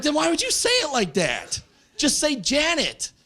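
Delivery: high, indignant comic protest; exasperated complaint. Retold or imitated. Exasperated reply to mom, retold.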